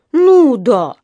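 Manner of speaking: loud, high, mocking disbelief; издевательски. A narrator's reading. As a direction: scoff at the claim loudly. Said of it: "издевательски спросил вахтёр". (издевательски, громко и высоко)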